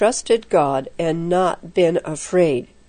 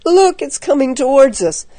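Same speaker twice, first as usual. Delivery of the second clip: high, panicky warning; scared boy urging escape; frightened cry; 6 semitones higher than usual. A narrator's reading. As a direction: cry it out in a spooked, raised voice. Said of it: "his teeth chattering".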